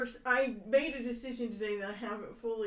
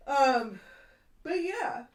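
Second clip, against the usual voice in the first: yawning; 5 semitones higher, 11 dB louder, slower than usual. Real, not acted. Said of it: "sorry for the yawn".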